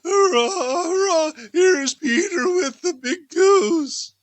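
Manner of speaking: joyful hurrah at the table; cheering. Acted. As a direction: cheer the goose like a delighted child. Children cheer the goose.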